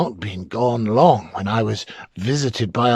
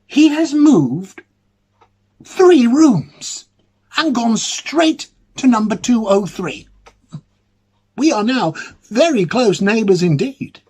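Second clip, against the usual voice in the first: astonished excitement; 10 semitones higher, 6 dB louder than usual. Acted. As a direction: burst out with breathless wonder at the news. Astonished news about the pale man.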